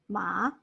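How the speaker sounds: "ma" sweeping upward like a question; second tone, rising. Shown as a demonstration. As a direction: let "ma" climb steadily. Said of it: "is going up".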